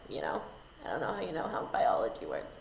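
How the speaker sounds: fast, hushed whisper aside; a quick conspiratorial whisper to the audience. Real, not acted. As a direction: whisper it as a cheeky secret. Aside about biology.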